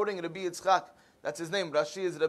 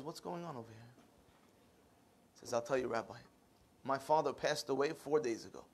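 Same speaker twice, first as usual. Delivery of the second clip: low, very soft storytelling voice; subdued grief; 6 semitones lower, 8 dB quieter than usual. Retold or imitated. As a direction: confide the loss quietly and low. Gentle exchange with the grieving janitor.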